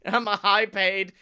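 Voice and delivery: normal talking voice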